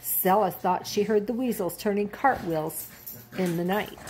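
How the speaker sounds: ordinary delivery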